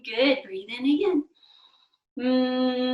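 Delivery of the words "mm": loud, long closed-lip hum; M sound. Shown as a demonstration. Teacher leads cookie breath, loud humming mm. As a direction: hum strongly, fading with the breath. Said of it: "we're gonna say".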